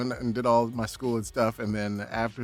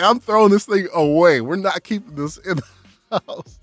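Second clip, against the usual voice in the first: louder, firm mother laying down the law; stern mom; 7 semitones higher, 9 dB louder than usual. Retold or imitated. Mom refusing to keep the gross cast, stern and loud. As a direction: put your foot down.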